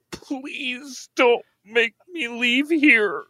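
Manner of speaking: slow, high whining beg; pleading. Acted. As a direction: beg pitifully. Begging for wheat.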